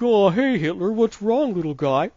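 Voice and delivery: squeaky cartoon kid cooing at a sad villain; South Park character. Cartoon kid comforting Hitler in a squeaky voice. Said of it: "They're like".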